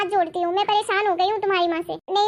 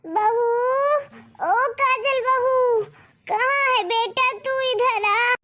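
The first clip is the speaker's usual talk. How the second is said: put-on old woman calling her daughter-in-law, higher and drawn out; calling out in pain; 3 semitones higher, much slower than usual. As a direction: cry out for help from the other room. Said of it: "क्यों चिल्ला रही हो?". (दर्द में ऊँची, खिंची आवाज़ में बहू को पुकारती सास)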